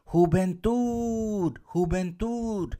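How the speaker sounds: drawn-out call of "juventud", holding the tud; calling out a word. Shown as a demonstration. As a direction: call juventud slowly as if from afar. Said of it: "voy a gritar la palabra".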